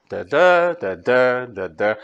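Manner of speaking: "d, d" as short weak, then long stressed. Shown as a demonstration. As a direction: demonstrate iambic pulse, second "d" of each pair held. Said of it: "is short and the d stressed is long".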